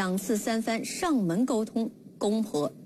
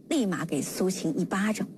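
normal talking voice

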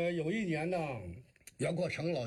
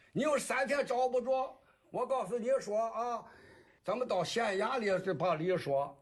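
high-pitched complaining parent in Jinan dialect; 6 semitones higher than usual. Shown as a demonstration. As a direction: demand answers in rhythmic Shandong patter, pitched up. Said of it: "您这个济南话". (济南话高声质问)

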